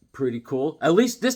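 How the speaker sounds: ordinary talk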